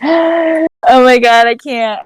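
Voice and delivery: gasp, then a high, drawn-out plea; half-hearted retold hysterics, fading at the end; mock hysterical crying. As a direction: imitate a panicked, tearful gasp. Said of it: "hysterically crying and screaming".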